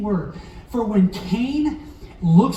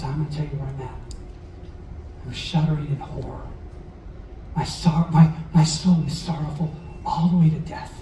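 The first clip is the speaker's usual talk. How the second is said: somber quote; 5 semitones lower, 4 dB quieter than usual. Acted. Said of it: "he says".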